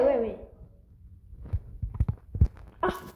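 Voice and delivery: ordinary talk, nothing marked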